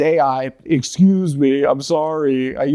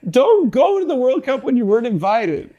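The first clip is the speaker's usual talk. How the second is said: mock whiny plea; 10 semitones higher than usual. Mocking Maguire's pleading.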